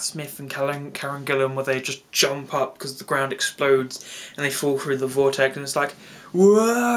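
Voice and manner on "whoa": long, high mock falling cry; mimicking an echoey trailer shout; imitated echoey cry. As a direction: draw out a loud, high whoa. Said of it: "it's all, like, echoey".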